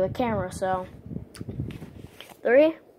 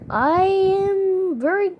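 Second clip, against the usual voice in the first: slow, stretched and higher; mock boastful; 8 semitones higher, much slower than usual. Bragging to viewers about his art, slow mock pride. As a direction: drag it out like a proud show-off.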